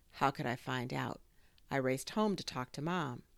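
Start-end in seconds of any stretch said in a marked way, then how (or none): none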